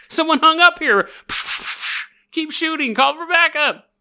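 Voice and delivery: hammed-up panicky officers; mock panicked cops. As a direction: overreact like jumpy cops. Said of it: "show up at the house and go".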